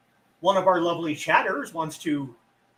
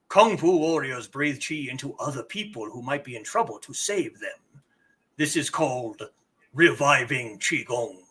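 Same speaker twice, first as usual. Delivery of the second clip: Nameless Elder; 5 semitones lower than usual. Acted. Elder teaching player about reviving Qigong, low epic elder voice. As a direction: intone it low and deliberately as an old master. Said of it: "now we return to Nameless Elder".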